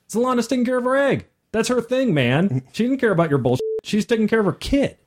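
high, fast and breezy, shrugging it off; breezy dismissive quote. Retold or imitated. GM voicing dismissive dragon mother.

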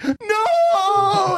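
high, drawn-out mock wail; mock crying wail. Victim protesting to laughing teammates, mock crying wail. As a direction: imitate a humiliated guy wailing no. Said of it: "And he starts crying".

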